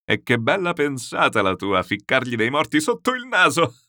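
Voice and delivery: roaring with amusement. Acted. General admiring the trick on the uncle, roaring with amusement. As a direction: stretch it out and climb into laughter.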